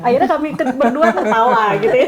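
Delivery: giggling while telling the punchline; real laughter in the voice; laughing. Real, not acted. Laughing at the story's end.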